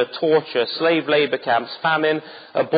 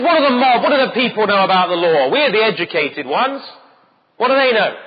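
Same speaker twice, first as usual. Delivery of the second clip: higher, louder sneer of the elite; scornful leaders; 7 semitones higher, 5 dB louder than usual. Retold or imitated. Preacher voices the leaders despising the common people, sneering. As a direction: look down your nose at the mob. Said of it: "They said".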